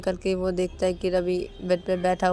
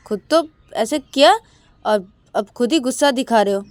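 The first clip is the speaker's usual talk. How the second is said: loud, higher-pitched reproach; indignant accusation; 5 semitones higher, 8 dB louder than usual. A narrator's reading. Siya accusing Shruti who is cross with her, indignant. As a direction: throw the blame back, annoyed.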